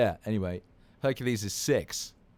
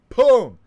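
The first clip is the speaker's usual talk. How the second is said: explosive high, very loud boom; cannon boom; 19 dB louder than usual. Retold or imitated. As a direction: burst it out like a cannon going off. Imitating the glitter cannon firing.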